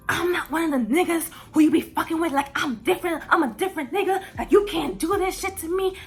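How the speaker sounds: mock angry yelling. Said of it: "all up in my face yelling".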